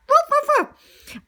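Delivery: high playful woofs; high little barks; dog barking. Acted. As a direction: bark like a small dog. Lady barks.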